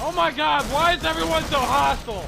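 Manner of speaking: high, loud frantic shouting; panicked, indignant yelling; yelling under fire. Acted. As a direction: shout over gunfire to the attackers. Yelling to attackers amid gunfire.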